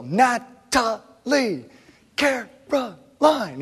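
drawn-out, high sing-song calling of names; kids yelling names. Retold or imitated. Imitating daughters yelling each other's names upstairs. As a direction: stretch each name out like a kid yelling. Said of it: "whenever they yell".

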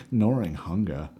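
low-pitched, oddly intoned phrase; odd low delivery. Real, not acted. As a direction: say it low, in a strange, put-on way. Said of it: "why I said it like that".

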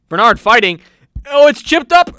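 sportscaster exclaiming at the play; excited commentator call. Acted. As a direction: burst out as the ball is chipped. Commentator reacting to chip shot.

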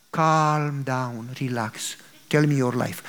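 low and soft, settle him down; calm soothing. Acted. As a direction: coax him to relax. Rich man soothing visitor.

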